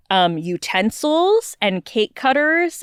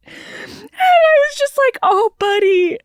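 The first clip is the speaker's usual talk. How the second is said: high, cracking through laughter; laugh-crying; 13 semitones higher, slower than usual. Real, not acted. Friend laughing about the groom's ruined cake cutter. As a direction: squeak it out while laughing helplessly.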